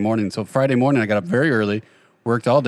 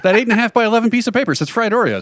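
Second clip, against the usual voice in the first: higher-pitched mock argument; retold insistent denial; 8 semitones higher than usual. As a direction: argue back in a high, put-on protest. Acted dispute over the sign.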